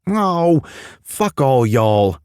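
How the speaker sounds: high British voice, slow y'all; mock-grumpy Brit saying y'all; scowling Pacey. Pacey scowling at his friends in British voice. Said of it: "Pacey scowled".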